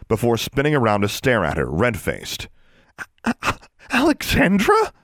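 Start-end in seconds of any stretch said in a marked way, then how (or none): 3.2-4.9 high stammering squeak; a stifled squeal, stuttering. A narrator's reading. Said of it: "she squeaked".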